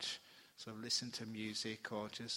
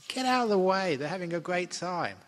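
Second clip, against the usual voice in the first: much louder and higher, shooing people off; mock shout; 7 semitones higher, 13 dB louder than usual. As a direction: bark it like shooing away busybodies. Telling retreatants to leave criers.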